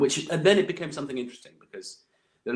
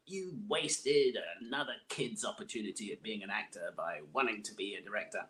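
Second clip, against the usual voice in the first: angry vice principal; 6 dB quieter than usual. Retold or imitated. Vice principal berating a would-be director, angry mock telling-off. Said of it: "he was so angry with me".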